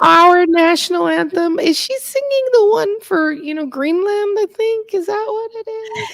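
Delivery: high squeaky mock bewilderment; a mock-baffled voice pitched high; mocking confused audience. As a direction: play a baffled listener, way up high. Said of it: "She's butchering language so much".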